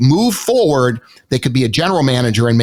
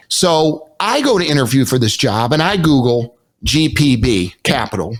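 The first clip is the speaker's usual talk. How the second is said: ordinary talk, nothing marked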